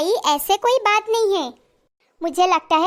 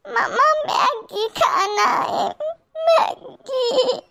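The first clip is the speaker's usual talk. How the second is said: put-on drawn-out crying child voice; whining child; 7 semitones higher, much slower than usual. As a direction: whine for Maggi like a small girl. Child wants Maggi, crying. (रोते हुए बच्ची की ज़िद)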